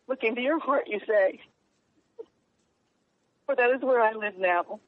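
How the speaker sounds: halting, moved, with long pauses; real emotion interrupting the poem's final lines; emotional poem reading. Real, not acted. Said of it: "it makes me very emotional to read it".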